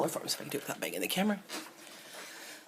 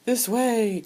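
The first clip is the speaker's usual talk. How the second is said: high, raised-voice call; 9 semitones higher, 6 dB louder than usual. Acted. Reading a toy figure's famous speech balloon, calling out.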